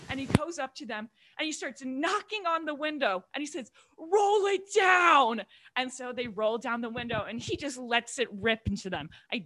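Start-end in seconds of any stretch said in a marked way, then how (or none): 4.0-5.4 loud, high, long drawn-out demand; angry dad yelling. Retold or imitated. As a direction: bellow it slowly like a furious father. Acting out furious dad at window.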